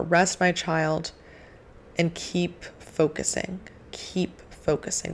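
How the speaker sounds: a hushed, reassuring voice of God, almost whispered at the end; calm soft divine voice. Retold or imitated. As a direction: soothe like a gentle parent. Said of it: "God talks in a very calm, soft tone".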